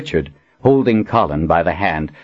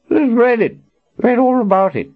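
put-on high old wizard, mouth full. A narrator's reading. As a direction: talk through a mouthful of rice cake. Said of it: "Zed spoke with his mouth full".

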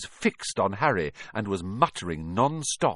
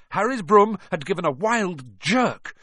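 usual speaking voice

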